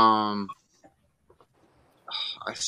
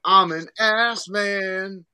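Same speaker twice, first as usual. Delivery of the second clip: theme song quote; 5 semitones higher, 4 dB louder, much slower than usual. Retold or imitated. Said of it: "Billy Gunn's when it was".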